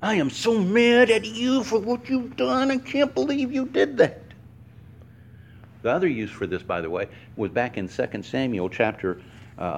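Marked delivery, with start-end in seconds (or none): 0.0-4.2 higher, mock-furious Jonah voice; preacher plays Jonah ranting at God, much higher and mock-furious; angry Jonah. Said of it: "That's what Jonah was doing at God".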